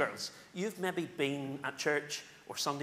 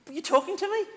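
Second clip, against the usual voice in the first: high, louder, eager question; puzzled little boy; 7 dB louder, faster than usual. Acted. Boy Samuel asking old Eli, puzzled and high. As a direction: pipe up like a puzzled child.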